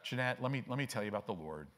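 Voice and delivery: low, quiet, listless mumbling testimony; mock half-hearted witness. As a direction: imitate someone witnessing with no freshness at all. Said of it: "and you're just like".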